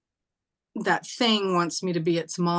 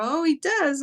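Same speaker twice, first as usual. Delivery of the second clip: touched surprise; 9 semitones higher than usual. Retold or imitated. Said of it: "And I go".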